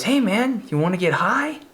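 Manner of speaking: lightly voiced shady teen offering weed; imitating Rodney's invitation. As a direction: sell it like a sly stoner offer. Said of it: "He goes".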